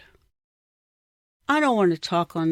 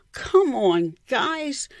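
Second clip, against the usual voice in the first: ordinary delivery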